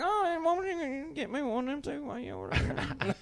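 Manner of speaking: a hammed-up drunk aunt asking for a beer. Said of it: "Lisa will be like".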